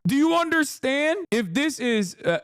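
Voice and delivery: usual speaking voice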